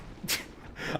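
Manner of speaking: unvoiced shaky laugh after a fright; breathy nervous laugh. Real, not acted. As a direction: laugh off the scare under your breath. Laugh after jump scare.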